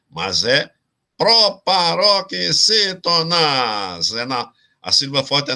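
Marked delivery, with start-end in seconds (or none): 1.2-4.1 high, very slow syllable-by-syllable delivery; drawn-out term. Shown as a demonstration. Teacher giving students the correct category, drawn-out term. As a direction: stretch out every syllable of "proparoxítonas".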